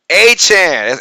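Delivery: "Achan" said AY-chan; "Achan" said AY-chan, firmly; Achan, Louisiana way. Shown as a demonstration. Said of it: "is pronounced Achan".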